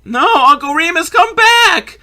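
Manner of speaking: put-on a child's pleading shout; boy calling out. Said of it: "he's like".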